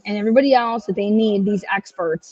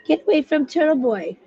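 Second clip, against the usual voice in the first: sneering imitation, pitched higher; a higher-pitched mocking echo of the critics; mocking quote; 5 semitones higher than usual. Vlogger mocking critics who warned against Turtle Boy, sneering. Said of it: "Everybody said".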